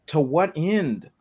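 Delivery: slow, exasperated question; drawn-out exasperation. Real, not acted. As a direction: stretch out TO WHAT END. Exasperated at the game's premise.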